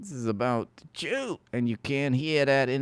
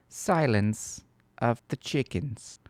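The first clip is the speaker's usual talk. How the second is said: trailer voice; slower than usual. Pretend. Movie-trailer parody.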